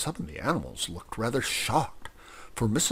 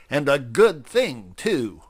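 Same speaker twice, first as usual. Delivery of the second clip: loud voice; 4 semitones higher, 6 dB louder than usual. Acted. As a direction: proclaim it to the whole hall. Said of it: "said old Wibbly in a loud voice".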